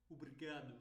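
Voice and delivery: "obrigado" squeezed short, European style; European, quick, closed. Shown as a demonstration. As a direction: swallow the vowels in obrigado, as in Portugal. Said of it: "pronúncia mais rápida e fechada".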